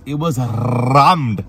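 Bradford accent with a long rolled R on "rammed". Shown as a demonstration. Host describing the packed takeaway, playful Bradford accent. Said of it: "I said it in a Bradford accent".

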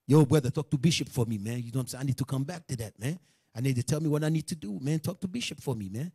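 low, soft appeal to a brother; acted humble asking for help; humble plea. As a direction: plead humbly, keeping the voice down. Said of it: "how humble I'm gonna be".

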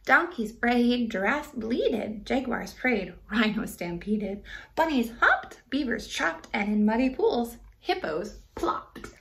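rhythmic rhyming read-aloud; story narration. A narrator's reading. Reading a rhyming book to kids, gently lilting. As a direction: read the verse to children, bouncing the rhymes.

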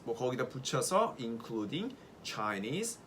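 ordinary delivery

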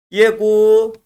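drawn-out Yegu in model reading; lengthened syllable. Shown as a demonstration. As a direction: stretch the end of Yegu. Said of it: "Buralarda okuyalım".

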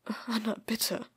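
mildly desperate narrated plea; understated desperate appeal. A narrator's reading. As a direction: plead with a touch of desperation. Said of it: "Tims Stimme war verzweifelt".

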